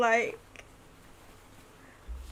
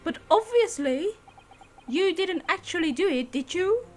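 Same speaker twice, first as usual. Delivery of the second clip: much higher, firm lady's voice; prim assistant voice; 9 semitones higher than usual. Acted. Assistant defends her client to the court, high firm voice. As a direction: stand up for the client, polite but firm. Assistant reading newspaper aloud.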